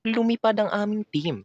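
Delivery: high, put-on TV host voice; Jessica Soho. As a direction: mimic a news-magazine host's intro. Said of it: "Mala Jessica Soho yun". (ginagayang boses ng TV host)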